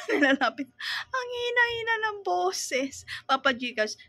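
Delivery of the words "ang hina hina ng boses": exasperated complaint. Acted. Complaining about soft voices.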